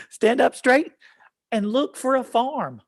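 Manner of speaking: high, sing-out action-poem reading; poem read to children. Acted. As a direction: lead children through each action.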